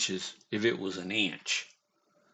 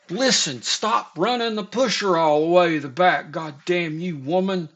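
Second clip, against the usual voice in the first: higher, louder mock yell at her; retold boss yelling at the worker, raised and angry; 6 semitones higher, 6 dB louder than usual. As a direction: imitate the boss bawling her out. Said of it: "He cussed her".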